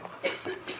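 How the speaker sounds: high, breathy disbelief; startled outburst. Retold or imitated. Startled addict hearing visitors at the door, breathy disbelief. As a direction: react as if caught off guard. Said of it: "And I'm like".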